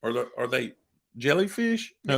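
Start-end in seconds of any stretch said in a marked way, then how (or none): 1.2-1.9 puzzled question. Shown as a demonstration. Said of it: "No, they're jellyfish".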